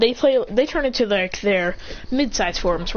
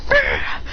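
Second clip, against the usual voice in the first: much higher strangled argh; a sharp cry of pain; pained shriek. Acted. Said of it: "punches Naruto where it hurts".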